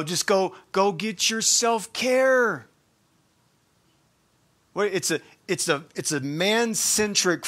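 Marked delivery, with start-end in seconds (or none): 0.0-2.7 higher, slow, mocking sing-song; mock self-care advice. Preacher mocking popular self-care advice, slow and sneering. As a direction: parody the cliché dismissively.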